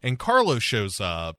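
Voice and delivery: normal talking voice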